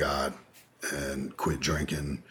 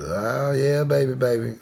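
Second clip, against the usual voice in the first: high, louder put-on aunt voice; aunt's way of talking; 9 semitones higher, 5 dB louder than usual. Nephew showing how his aunt always talked, high put-on voice. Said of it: "that's how she talks".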